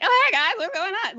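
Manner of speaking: exaggerated high, chirpy greeting; own high-pitched voice. Shown as a demonstration. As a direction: play up your high little voice, ending in a laugh. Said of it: "I talk like this".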